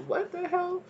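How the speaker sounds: high, drawn-out disbelief on hell; incredulous. Real, not acted. Reader reacting to the alpha male energy, stunned and incredulous.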